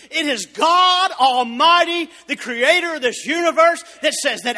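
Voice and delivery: loud, high, slow proclamation that rises to a shout; full-force preaching. Acted. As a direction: thunder it out from the pulpit.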